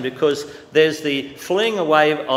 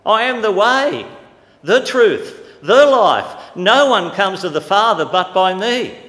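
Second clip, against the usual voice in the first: preaching scripture; 4 semitones higher, 7 dB louder, slower than usual. Acted. Quoting Jesus, rising preaching force.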